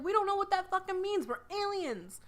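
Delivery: high confused alien. Retold or imitated. Aliens replying to Dean, high baffled voice. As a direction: puzzle over human words, high. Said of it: "they were like".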